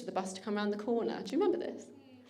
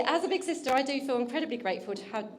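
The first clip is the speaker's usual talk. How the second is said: usual speaking voice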